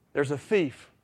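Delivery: ordinary delivery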